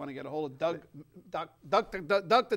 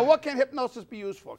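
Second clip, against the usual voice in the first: usual speaking voice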